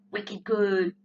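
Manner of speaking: imitating Boston speech, slowly drawn out; Boston accent. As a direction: do a slow Boston "wicked good". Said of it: "up in Boston, everything's, like".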